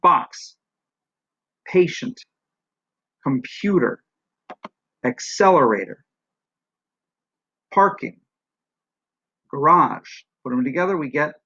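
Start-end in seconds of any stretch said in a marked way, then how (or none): 0.0-10.1 isolated words, each stressed syllable hit hard, then falling; English word stress. Shown as a demonstration. Teacher demonstrating English stress on single words, stressed syllables punched. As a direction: punch one syllable in each of "Box, patient, computer, accelerator, parking, garage". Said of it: "this is how the stress works out in English".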